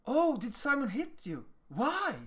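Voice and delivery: put-on high-pitched, concerned questioning of a child; mirroring adult. Adult mirroring a child who was hit, concerned questioning. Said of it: "Just mirror it. Just say".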